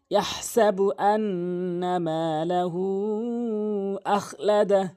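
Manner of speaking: slow, drawn-out tajweed chant; Quran recitation. Shown as a demonstration. Teacher reciting the verse after spelling it, slow melodic chant. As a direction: recite with long held vowels.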